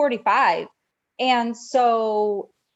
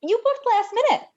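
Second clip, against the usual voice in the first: much higher, astonished reaction; real surprise; 11 semitones higher than usual. Real, not acted. Host reacting to a cruiser's last-minute dining booking, amazed. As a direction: pitch jumps up in genuine amazement.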